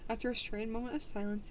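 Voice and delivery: plain reading voice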